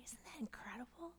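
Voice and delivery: hushed, nearly whispered wonder; awed hush. Real, not acted. Teacher marvelling at someone who stands by you, awed hush. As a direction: drop right down to an awed whisper.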